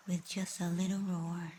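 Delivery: normal talking voice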